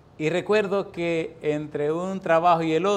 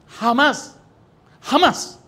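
rising, much louder and higher double jamás; emphatic shout; 11 semitones higher, 8 dB louder, much slower than usual. Retold or imitated. Old man vows to the young secretary, shouting. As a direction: hammer it home like a solemn promise. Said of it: "Le garantizo a usted".

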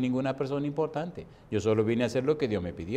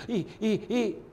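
gemiditos agudos de susto, imitados; asustadito; 9 semitones higher than usual. Retold or imitated. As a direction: imita a un hombre encogido de miedo. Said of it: "y así asustadito". (quejiditos de miedo fingido)

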